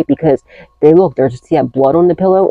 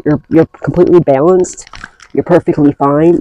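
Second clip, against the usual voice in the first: usual speaking voice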